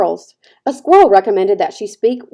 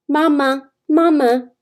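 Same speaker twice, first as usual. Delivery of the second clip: put-on higher little baby-bird call; a lightly voiced hatchling calling mama; gosling peep; 6 semitones higher, slower than usual. A narrator's reading. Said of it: "peeped the gosling".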